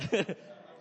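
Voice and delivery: short real laugh, breathy. Real, not acted. Preacher laughing at his own mistake, breathy laugh. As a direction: laugh at yourself.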